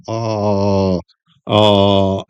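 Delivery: ah with tongue pulled back, swallowed and dark; curled vowel. Shown as a demonstration. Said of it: "if I pull my tongue back a bit".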